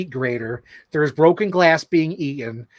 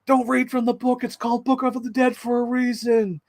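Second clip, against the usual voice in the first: higher, urgent warning voice; cameo warning; 9 semitones higher than usual. Retold or imitated. Retelling the Bruce Campbell cameo as a higher, urgent warning. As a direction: warn them off the book.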